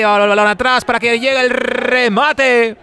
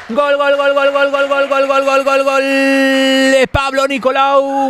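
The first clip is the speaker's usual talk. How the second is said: goal call; 3 semitones higher than usual. Real, not acted. Goal scored, live commentary.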